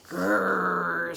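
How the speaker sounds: rolled growl of a thwarted fish; grudging growl. Acted. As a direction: growl at losing the bargain. Big fish growls at the bargain.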